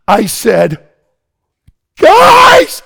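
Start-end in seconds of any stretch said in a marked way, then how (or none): 2.0-2.8 a high, loud yell calling the family over; retold scream. Preacher retelling waking the boys: excited scream. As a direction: shout it out of the tent, way up high. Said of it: "I started to scream".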